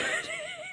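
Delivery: a quick rising giggle; short laugh. Real, not acted. Giggling to viewers about the dusty wardrobe, short laugh. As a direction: laugh at the mess under the shoes.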